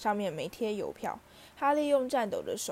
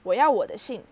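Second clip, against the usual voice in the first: put-on much louder, a boy yelling his demand; shouting; 4 semitones higher, 7 dB louder than usual. A narrator's reading. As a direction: yell it, refusing to budge. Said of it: "他大喊". (哈利大声喊着要他的信)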